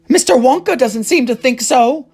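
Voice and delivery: very high loud panicked scream; Mrs Gloop screaming. A narrator's reading. Mother screams as her son sinks in chocolate, very high. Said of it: "cried Missus Gloop".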